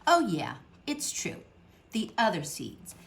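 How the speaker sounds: ordinary delivery, nothing marked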